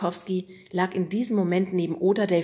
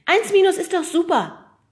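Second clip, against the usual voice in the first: put-on very high, loud girl's voice; calling out; 13 semitones higher, 11 dB louder than usual. Oda cuts in on the teacher, high and loud. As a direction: chirp it in brightly. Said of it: "rief Oda dazwischen".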